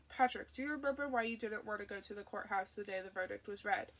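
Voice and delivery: normal talking voice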